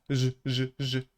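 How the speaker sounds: "Z" as short buzzing zh bursts; voiced zh sound. Shown as a demonstration. Teacher showing the same dotted Z, voiced. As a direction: repeat "z" as a voiced zh. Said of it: "I use my throat to produce the sound".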